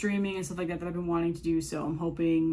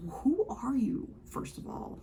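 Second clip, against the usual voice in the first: whispering; 6 semitones higher, 3 dB quieter, slower than usual. Real, not acted. Mocking whisper at commenters.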